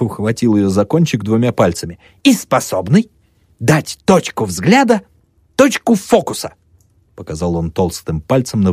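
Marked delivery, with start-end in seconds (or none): 2.2-6.5 pompous lecturer. Acted. Pyramidov explaining, voiced.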